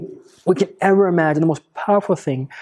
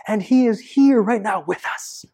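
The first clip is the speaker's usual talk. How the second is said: hushed whisper; 3 semitones higher than usual. Acted. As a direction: whisper it, as if sharing a secret. Awe at God's presence.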